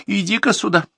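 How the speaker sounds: high, loud call through the door; calling loudly. Acted. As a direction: call the daughter from the kitchen. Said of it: "громко позвала".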